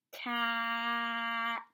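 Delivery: "Cat" with a quick c, long held vowel, quick t; sounded out slowly. Shown as a demonstration. Teacher modelling for the student, "Cat" sounded out slowly. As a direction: stretch the middle of "Cat" on one level note. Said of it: "Another quick sound".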